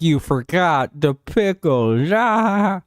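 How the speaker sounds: slow, drawn-out complaint that breaks into a high yelp; mock customer losing it over pickles; mock freakout. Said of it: "be like".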